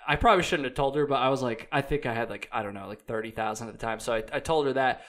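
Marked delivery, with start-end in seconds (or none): none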